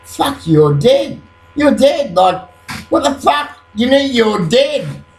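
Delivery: re-enacting the panic, pitch jumping up; freaking out. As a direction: act out a startled, disbelieving realization. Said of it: "freaking out".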